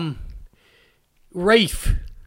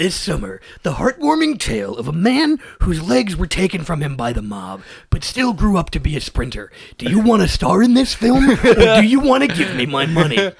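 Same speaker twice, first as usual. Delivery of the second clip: movie-trailer henchman. Acted. Henchman pitching a film to a debtor, trailer voice. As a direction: pitch the threat as a coming film. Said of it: "henchman who talks like it's a movie trailer".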